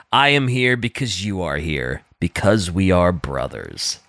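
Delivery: slow, solemn reading of a book quote; read-aloud quote. Shown as a demonstration. Friend reading a quote to the group, slow and solemn. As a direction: read it out gravely, like a vow. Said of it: "he describes his own character in this quote".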